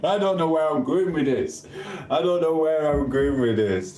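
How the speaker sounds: high, giggling self-mockery; amused high-pitched admission; laughing. Real, not acted. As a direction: laugh off the rambling. Laughing at own gushing.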